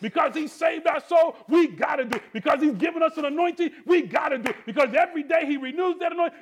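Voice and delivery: loud, rising shouted refrain; preaching at full force. Real, not acted. Preacher rousing the church to serve others, shouting. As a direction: drive each line home to the congregation.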